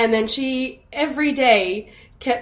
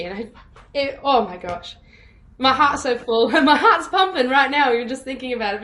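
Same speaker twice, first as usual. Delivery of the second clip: ordinary talk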